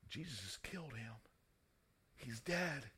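very soft, acted murmur of a crowd; quiet, dropped-voice pronouncement; hushed verdict. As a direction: drop far down, as if beside a body. Shocked onlookers' words, acted.

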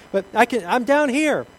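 high loud anxious call upward; calling back. Acted. Stranded man calls up to the unseen voice, loud and anxious. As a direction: shout up to the voice above.

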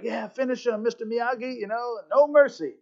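lightly acted onlookers urging a fighter on; a touch of eager bloodlust; egging him on. Voicing onlookers egging Miyagi on.

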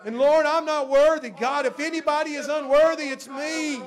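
loud, high, full-force praying aloud; impassioned prayer. Real, not acted. Preacher thanking God before the congregation, impassioned prayer. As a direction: cry out to God, drawing out the key words.

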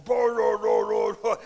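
loud burbling tire noise; imitating roaring race tires; tire roar imitation. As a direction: make the sound of the tires tearing past. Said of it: "the tires going".

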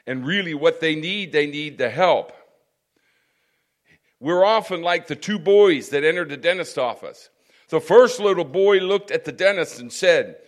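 loud, emphatic sermon delivery; forceful preaching. Real, not acted. Preacher challenging listeners on donations versus total commitment, forceful preaching. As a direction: drive the point home to the congregation, loudly.

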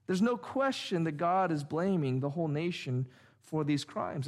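usual speaking voice

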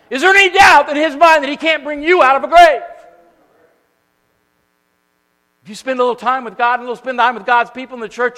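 high, loud fervent preaching; preaching at full force. Real, not acted. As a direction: preach it with full fire to the congregation.

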